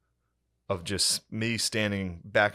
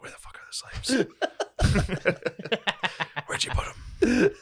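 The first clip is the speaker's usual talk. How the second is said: slow, higher whispered demand with a laugh; mock whisper; 12 semitones higher, slower than usual. Visitor whispering during a no-talking tour, slow and laughing. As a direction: hiss it under your breath on the tour. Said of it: "I was like".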